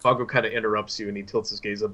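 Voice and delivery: normal talking voice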